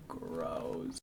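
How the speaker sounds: breathy whispered gross; whispered disgust. Acted. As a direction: recoil in a whisper. Child disgusted by ooze.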